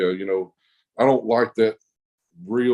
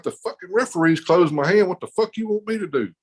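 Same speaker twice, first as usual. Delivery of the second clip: retold exasperated protest; 8 semitones higher than usual. Acted protest in table quarrel.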